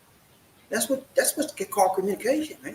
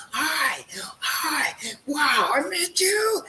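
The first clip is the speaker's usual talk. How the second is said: excited greeting; 8 semitones higher, much slower than usual. Pretend. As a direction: gush it like meeting someone at last. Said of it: "You wanna be excited when you meet her".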